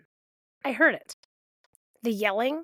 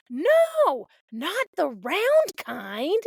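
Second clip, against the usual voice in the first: put-on high whiny toddler, the no pushed loud; demanding kid voice; 8 semitones higher, 4 dB louder, slower than usual. Toddler bossing mom about pancakes, high whiny insistence. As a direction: insist like a tiny tyrant. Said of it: "they're yelling demands".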